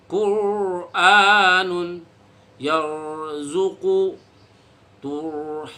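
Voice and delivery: Qur'anun yarzuqu turhamun very slow, heavy r; slow recitation. Shown as a demonstration. Teacher repeating the recitation, slow and heavy. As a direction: recite it drawn out for the class.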